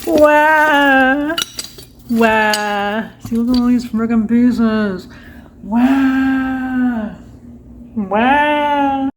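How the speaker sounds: fake-bawl like a toddler; mock wailing.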